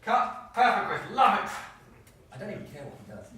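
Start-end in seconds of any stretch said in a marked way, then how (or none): 0.0-1.5 loud, approving film-set shout; projected director's praise; director calling out. Acted. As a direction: call cut and praise the actor.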